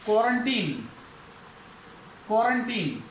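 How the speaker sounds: put-on क्वारंटीन as KWO-run-teen, said as a model; o first and long teen last in क्वारंटीन; correct pronunciation. Shown as a demonstration. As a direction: repeat क्वारंटीन as separate falling model words. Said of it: "ओ की sound".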